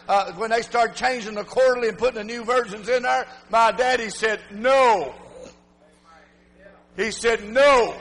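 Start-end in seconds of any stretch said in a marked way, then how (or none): 4.5-5.1 firm, drawn-out "No"; one weighty "No", set apart by pauses; emphatic refusal. Retold or imitated. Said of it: "Very emphatic". 7.4-7.9 firm, drawn-out "No"; one weighty "No", set apart by pauses; emphatic refusal. Retold or imitated. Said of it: "Very emphatic".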